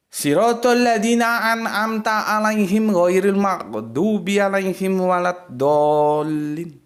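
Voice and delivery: slow, loud chanted recitation; Quran recitation. Acted. Teacher reciting the seventh verse for students, slow and measured. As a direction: recite it fully, drawing the words out.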